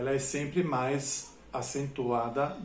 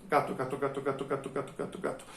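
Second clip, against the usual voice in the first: gato rattled off very fast, trailing softer; rapid-fire repetition; 3 dB quieter, much faster than usual. Shown as a demonstration. Teacher showing gato can go much faster, rapid-fire. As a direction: repeat gato in one quick unbroken run. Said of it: "podem ser pronunciados bem mais rápido".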